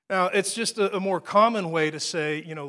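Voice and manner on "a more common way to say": ordinary delivery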